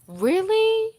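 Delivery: high, loud, disbelieving question; surprised. Real, not acted. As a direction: shoot up high in real disbelief. Reacts to co-host's flat denial.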